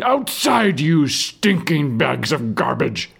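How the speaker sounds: high, slow furious yell; angry shout. A narrator's reading. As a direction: scream the order at them. Guard yelling at prisoners.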